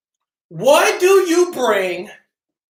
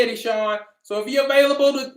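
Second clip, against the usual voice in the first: ordinary delivery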